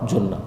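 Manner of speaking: جنہ said "junna", with a short u; "u" vowel in جنہ. Shown as a demonstration. Teacher lists the three readings for students, جنہ as "junna". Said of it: "جنہ بھی پڑھا جا سکتا ہے".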